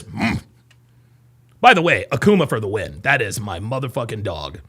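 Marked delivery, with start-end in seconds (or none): 0.0-0.4 low, soft grunt; terse fighter's grunt. Retold or imitated. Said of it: "He just says".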